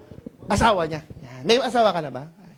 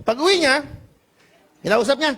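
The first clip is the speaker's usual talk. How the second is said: normal talking voice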